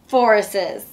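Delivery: ordinary delivery, nothing marked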